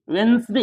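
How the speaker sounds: वेंसडे as "wens-de", no first d; silent-D pronunciation. Shown as a demonstration. Teacher corrects pupils, वेंसडे with silent d. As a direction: go straight from n to s in वेंसडे. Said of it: "यानी दी हम नहीं".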